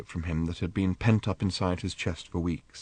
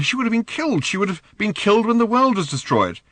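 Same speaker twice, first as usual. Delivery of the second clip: urgent protest; 11 semitones higher, 3 dB louder than usual. Acted. Arthur urgently arguing.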